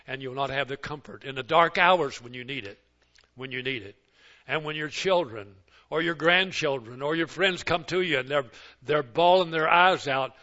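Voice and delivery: ordinary talk, nothing marked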